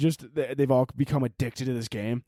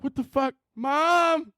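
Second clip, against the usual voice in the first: put-on much higher, childish yelp for Mom; a scared little boy crying out to his mother; panicked kid calling Mom; 11 semitones higher, much slower than usual. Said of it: "And Jimmy's like".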